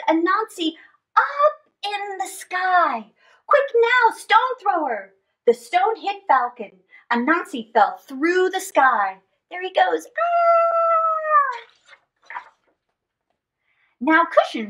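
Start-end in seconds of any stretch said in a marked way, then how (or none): 3.5-5.1 urgent call. Acted. Urging the son. 9.5-11.6 high excited yelp; excited cry. Acted. Anansi falling through sky.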